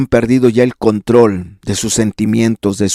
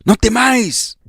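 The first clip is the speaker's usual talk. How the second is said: higher, soft reassurance; gentle Jesus; 11 semitones higher, 3 dB quieter than usual. Acted. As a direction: soothe a frightened friend. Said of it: "Esas palabras de Cristo".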